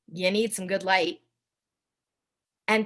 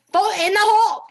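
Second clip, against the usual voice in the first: mock Scottish; 9 semitones higher, 8 dB louder than usual. Callback to Scottish line.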